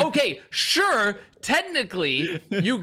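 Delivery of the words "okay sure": slow, dismissive okay sure; drawn-out mock agreement; sarcastic. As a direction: humor them, unconvinced. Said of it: "And I'm like".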